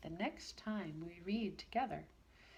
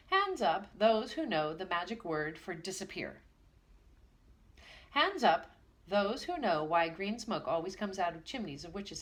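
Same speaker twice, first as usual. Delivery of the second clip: rapid-fire teacher; 2 semitones higher, 5 dB louder than usual. Acted. Schoolmaster fires questions at brownies, loud. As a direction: snap out each question.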